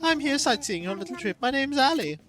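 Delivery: high, sociable character voice; friendly tourist. Acted. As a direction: introduce yourself as a delighted tourist.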